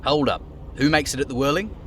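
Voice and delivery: ordinary talk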